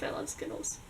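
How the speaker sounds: hushed, secretive whisper about Skittles; whispered confession. Shown as a demonstration. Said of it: "True fact".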